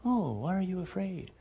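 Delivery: soft, unruffled question; mock-gentle. Shown as a demonstration. What Jesus doesn't say in the storm, mock-gentle and soft. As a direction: ask it far too quietly for a storm. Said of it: "He doesn't say".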